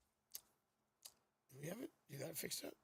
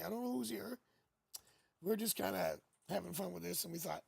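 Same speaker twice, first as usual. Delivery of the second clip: ordinary talk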